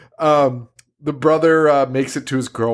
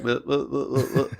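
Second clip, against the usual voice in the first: mock creature babble; 6 dB quieter than usual. Imitating bat creature's babble.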